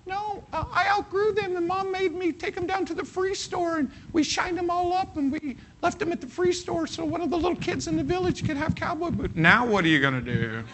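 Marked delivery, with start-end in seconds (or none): 0.0-9.3 put-on very high child explaining sweetly; squeaky little girl story voice. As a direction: play the small girl telling how she gave her boots away. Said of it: "and she said".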